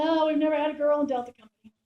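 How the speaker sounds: loud, booming drill-sergeant announcement; mock loud sergeant. First sergeant announcing to the reporter, loud.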